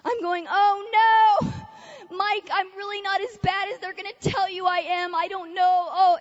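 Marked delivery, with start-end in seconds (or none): 0.5-1.5 high, loud mock-horrified cry; retold horror. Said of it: "the horror that came over me". 2.2-6.2 higher-pitched, mock-desperate plea; retold panic. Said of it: "I was feeling all those emotions".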